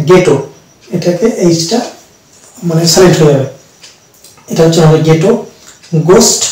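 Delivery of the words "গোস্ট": ordinary talk, nothing marked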